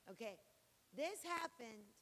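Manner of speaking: usual speaking voice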